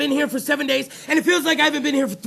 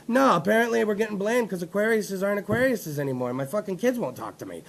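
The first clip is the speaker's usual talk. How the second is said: dejected worker; 5 semitones lower, 6 dB quieter than usual. Retold or imitated. Voices blamed NASA worker.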